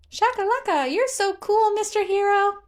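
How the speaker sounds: very high, loud squealing praise; a fan squealing over a hero; gushing admiration. Acted.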